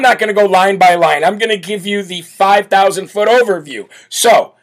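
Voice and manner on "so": segment announcer. Real, not acted. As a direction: announce the section like a broadcaster.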